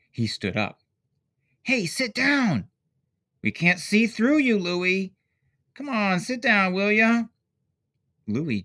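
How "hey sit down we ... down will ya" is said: high, loud call to sit down; kids yelling. A narrator's reading. Kids at the show yelling at Louis to sit down, loud. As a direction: shout it across the crowd, annoyed.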